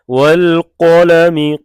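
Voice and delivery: वल कलमि said loud with a heavy qaf. Shown as a demonstration. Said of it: "कॉफ को पुर पढ़ेंगे मोटा".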